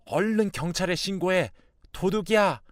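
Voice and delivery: high-pitched urgent order; urgent alarm. Acted. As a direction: raise the alarm about a thief. Husband urges startled wife to call police. (다급하게 높여 외치는 말투)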